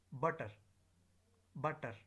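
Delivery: "butter" with stressed first syllable, weak schwa ending. Shown as a demonstration. Teacher drilling weak forms, "butter" ending in schwa. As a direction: punch the start of "butter", reduce the end. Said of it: "schwa sound".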